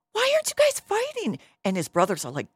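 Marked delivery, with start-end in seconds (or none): 0.1-1.4 very high, louder mock outcry; incredulous question. Young David running up to the fighters, high-pitched and incredulous. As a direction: squeak it out in acted disbelief.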